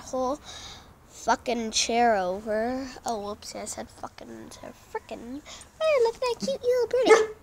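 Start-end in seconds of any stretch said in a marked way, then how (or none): 5.8-7.3 much higher, fast cooing. Real, not acted. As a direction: coo at the little bird. Cooing at a bird.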